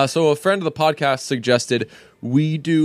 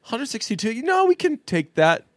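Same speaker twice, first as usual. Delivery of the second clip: very high put-on voice; high mock negotiating voice; playacted startled official; 12 semitones higher than usual. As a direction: pretend to take a huge request in stride, pitched way up. Acting out Britain's reply to request.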